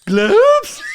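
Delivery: "Globes" shot up high and loud in disbelief; incredulous repeat. Real, not acted. Shocked by a friend's terrible accent, echoing "Globes" high and loud. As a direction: echo the word in shocked disbelief. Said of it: "What the fuck?".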